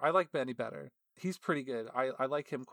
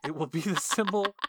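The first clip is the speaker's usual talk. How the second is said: lightly voiced eager boss, a bit higher; a hint of a grand announcement; reading the boss's letter; 5 semitones higher, 4 dB louder than usual. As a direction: pitch it like an eager official. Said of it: "the description that they gave to the artist".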